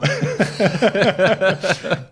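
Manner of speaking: laugh. Real, not acted. Laughing about the unhelpful bank.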